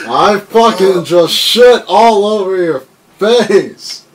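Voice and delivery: slow, loud gloating taunt; loud trash talk. Real, not acted. Trash talk after winning.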